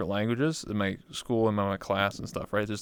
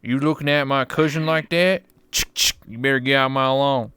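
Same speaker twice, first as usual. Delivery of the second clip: hammed-up Texas rancher bluster; southern accent; 4 dB louder than usual. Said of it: "the southern accent".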